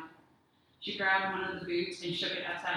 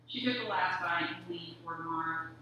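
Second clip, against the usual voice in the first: usual speaking voice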